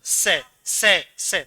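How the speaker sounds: स as "se", tongue at the teeth; dental s. Shown as a demonstration. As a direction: hiss स off the teeth, no sh. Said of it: "और ये दंत".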